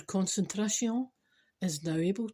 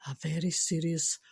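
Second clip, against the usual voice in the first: normal talking voice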